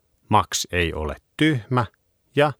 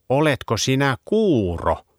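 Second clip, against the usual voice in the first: yapping; 6 semitones higher than usual. A narrator's reading. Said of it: "taksi räksytti".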